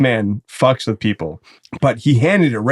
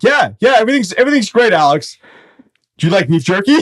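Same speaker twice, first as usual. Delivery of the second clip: mock dad; 7 semitones higher, 3 dB louder, faster than usual. Imitating suspicious dad on phone.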